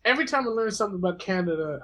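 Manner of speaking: normal talking voice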